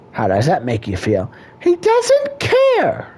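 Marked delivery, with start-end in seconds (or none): 1.6-3.1 put-on much higher falsetto girlfriend voice; whiny high-pitched wife voice; a high squeaky woman complaining to a counselor.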